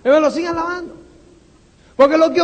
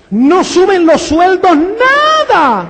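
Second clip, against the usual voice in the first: mock indignant complaint; 4 semitones higher, 5 dB louder, slower than usual. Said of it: "Me explotan todos los años en esas navidades".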